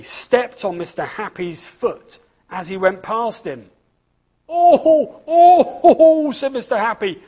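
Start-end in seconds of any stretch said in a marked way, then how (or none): 4.5-6.3 high, loud ouch cries while hopping; yelps of pain. A narrator's reading. Mister Happy hops after his foot is stomped, yelping. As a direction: squeal after a trodden foot. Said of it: "hopping on the spot".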